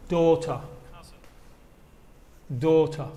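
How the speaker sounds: ordinary talk, nothing marked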